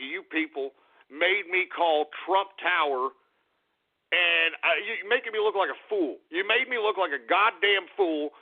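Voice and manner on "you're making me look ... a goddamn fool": ordinary delivery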